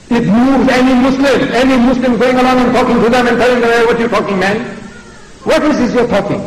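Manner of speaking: high-pitched, impassioned challenge; indignant preaching. Real, not acted. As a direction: preach with insistent outrage.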